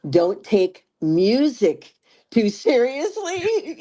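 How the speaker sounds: slow, high emphasis tipping into laughter; emphatic, laughing. Real, not acted. Singer sharing lessons learned, emphatic and laughing. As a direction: land each word, then crack up.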